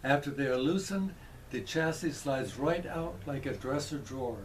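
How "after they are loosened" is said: ordinary talk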